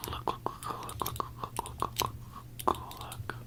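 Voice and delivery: quick hushed, whispery repetition; hushed urging. Pretend. Pointing out a flaw to people, hushed urging. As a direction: whisper it over and over, insistently.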